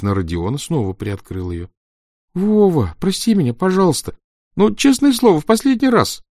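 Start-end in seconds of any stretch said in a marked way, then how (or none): 2.3-6.2 pleading apology. A narrator's reading. Makeup boy begging forgiveness.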